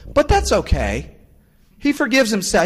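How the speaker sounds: lightly voiced kid excusing himself; cheerful kid voice. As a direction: chirp it out like a kid pleased with himself. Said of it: "he goes".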